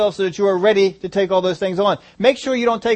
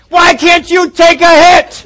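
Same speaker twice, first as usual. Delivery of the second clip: loud, high, slow jeering yell; mock fan shout; 11 semitones higher, 11 dB louder, slower than usual. Preacher playing a fan on his feet, hammed-up jeering shout. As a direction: jeer at the player like a rowdy fan. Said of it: "stood up and said".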